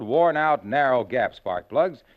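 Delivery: normal talking voice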